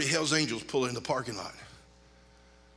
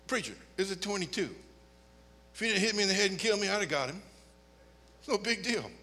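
softer, offhand dismissal; casual shrug; 3 semitones higher, 6 dB quieter than usual. Retold or imitated. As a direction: brush it off like nothing. Shrugging off danger.

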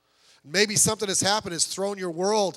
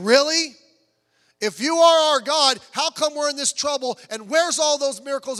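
higher, louder disbelieving questions; incredulous challenge; 5 semitones higher, 6 dB louder than usual. Retold or imitated. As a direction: act out a doubter demanding proof from God. Paraphrases Gideon challenging God.

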